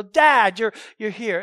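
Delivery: loud glad call to a father walking in; joyful cry to dad. Shown as a demonstration. Preacher acts a child greeting her father, loudly calling out. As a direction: shout for Dad, overjoyed. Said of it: "It's crying out".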